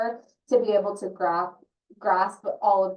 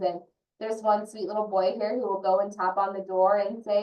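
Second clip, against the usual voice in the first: ordinary talk, nothing marked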